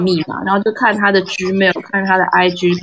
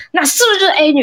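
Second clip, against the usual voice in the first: accusing question; 9 semitones higher, faster than usual. Retold or imitated. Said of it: "那个男就说：".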